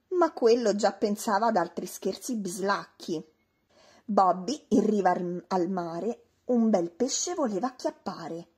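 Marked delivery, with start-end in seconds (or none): none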